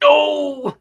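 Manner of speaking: a real burst of laughter, high and loud; delighted laughing cry. Real, not acted. Listener laughing out loud at the joke. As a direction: crack up at the punchline. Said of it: "That's a very good one".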